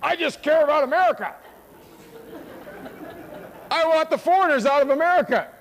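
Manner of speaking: hammed-up angry caller; ranting caller. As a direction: mimic a bellowing caller demanding the foreigners out. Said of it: "he rants and screams".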